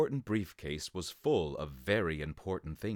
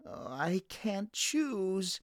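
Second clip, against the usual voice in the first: put-on much higher, slow, dithering child voice; whiny little boy; 12 semitones higher, slower than usual. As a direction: drag it out like a torn little boy. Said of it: "said Charlie".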